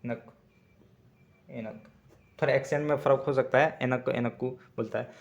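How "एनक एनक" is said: एनक said slowly twice, fading softer the second time; Tamil word demo. Shown as a demonstration. Teacher repeating एनक for students, slow and soft. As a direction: sound out एनक carefully, syllable by syllable. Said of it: "मुझे यानी एनक".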